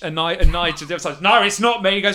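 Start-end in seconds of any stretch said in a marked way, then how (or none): 1.2-1.9 high, wary London thug, loud; nervous thug caught at knifepoint; collared ruffian pleading with the captor. Acted. GM voices second ruffian.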